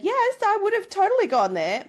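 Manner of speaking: high, excited gush of a yes; eager yes. Pretend. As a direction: squeal it like you can't resist. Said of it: "I would like to have said".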